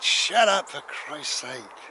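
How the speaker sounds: high, loud scolding burst, easing off; exasperated parent. Acted. Parent to noisy child in the garden, exasperated shout. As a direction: yell at a rowdy child. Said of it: "And your parents said".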